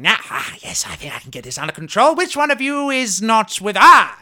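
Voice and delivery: high, strained wizard voice; wizard in character. Acted. Wizard taking the staff to get control, high agitated voice. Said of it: "the wizard starts saying".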